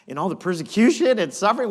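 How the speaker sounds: fast, laughing disbelief; chuckling incredulous aside. Real, not acted. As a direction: laugh at the irony as you say it. Laughing at the irony.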